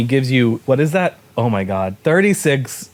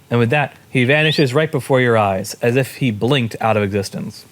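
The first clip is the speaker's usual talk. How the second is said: ordinary talk, nothing marked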